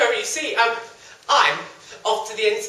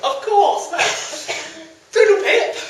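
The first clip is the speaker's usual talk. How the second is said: high posh lady voice; 8 semitones higher, 6 dB louder than usual. Acted. Lady replies.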